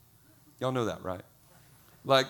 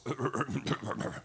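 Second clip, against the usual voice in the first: imitated throat clearing; 2 semitones lower, 6 dB quieter than usual. Man preparing to pray before church, pompous throat clearing. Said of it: "he had the right voice".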